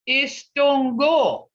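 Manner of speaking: Istongo drawn out slowly; teacher modelling Istongo slowly; slow model word. Shown as a demonstration. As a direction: slowly sound out Istongo syllable by syllable. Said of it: "Can y'all see how these sounds are being broke up".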